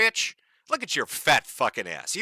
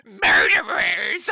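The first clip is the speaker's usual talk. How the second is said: drawn-out, slow screech, higher and louder; mock screeching; 5 semitones higher, 6 dB louder, much slower than usual. Commentator mimicking the screaming protester, slow mock screech. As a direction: wail it like a hammed-up cry. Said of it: "Can you hear him screeching?".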